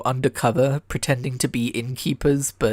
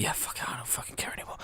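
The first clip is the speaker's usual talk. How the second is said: whisper; 14 dB quieter, faster than usual. Retold or imitated. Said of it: "He's like".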